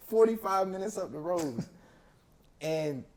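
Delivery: exasperated disbelief with laughter; pissed off. Real, not acted. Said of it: "Oh, you're pissed".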